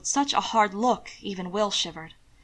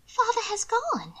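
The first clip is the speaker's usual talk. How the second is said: high, breathless genteel lady; high nervous young lady explaining herself; Mina pleading; 13 semitones higher than usual. A narrator's reading. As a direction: fuss anxiously in a high voice. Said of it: "Mina asked".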